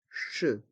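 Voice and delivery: "ch" as a soft SH sound; French "ch", with no hard K. Shown as a demonstration. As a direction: say "ch" as an isolated "sh". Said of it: "Le C et le H se prononcent".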